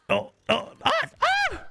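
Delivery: rising yelps into very high screams; panicked screaming. Real, not acted. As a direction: cry out in escalating real panic. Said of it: "Sorry for the screaming".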